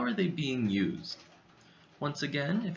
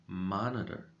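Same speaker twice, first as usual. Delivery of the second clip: "Monitor" with the first syllable MON given more weight; stress on MON; 4 semitones lower than usual. Shown as a demonstration. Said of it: "pronounced with a little bit more volume".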